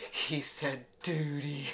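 tipsy, slowed-down impression; drunk sister. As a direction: drag it out like someone giggly drunk. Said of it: "My sister's just repeating everything".

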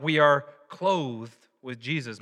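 ordinary talk, nothing marked

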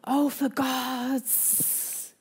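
slow, soft, exasperated groan of a phrase; a stifled curse under the breath; exasperated mutter. Shown as a demonstration. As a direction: parent hearing the kids break a vase, muttering. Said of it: "the kids breaking another vase".

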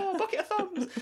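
very high silly English falsetto; mock English accent. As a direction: squeak nonsense in a hammed-up English voice. Mock English riff continues.

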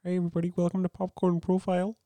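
Kermit. Acted. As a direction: play the cheery frog host greeting listeners. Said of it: "do your Kermit".

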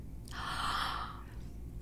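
quiet shocked intake of breath; a breathless gasp of shock; soft gasp. Retold or imitated. Shocked reaction to agent's words.

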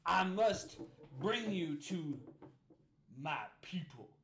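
loud, high, slow pompous lawman; sheriff Buford T. Justice. Acted. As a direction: drawl it out like a self-important sheriff.

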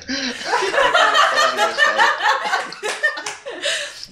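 long high-pitched helpless laugh; high squealing laugh. Real, not acted. Amused by a Southern phrase she can't decode, high laughter.

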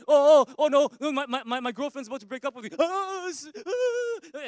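high, rushed panicky babbling; mock panic. Preacher mimics a panicked boyfriend about to be dumped, hammed-up panic. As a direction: ham up a frantic boyfriend's fear.

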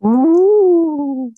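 high, loud spooky ghost ooh. Acted. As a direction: hoot it like a cartoon ghost. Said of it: "Version number two".

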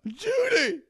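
very high-pitched holler; calling out. Acted. Webcaster calls out to Judy, high holler.

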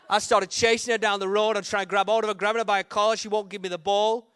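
normal talking voice

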